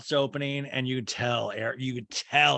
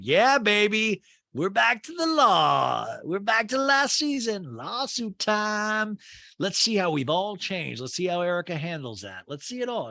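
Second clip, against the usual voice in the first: high, slow and gleeful; excited glee; 8 semitones higher than usual. Retold or imitated. Recapper gleefully reacting to lawsuit drama, high and slow. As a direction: savor it like juicy gossip. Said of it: "and I'm like".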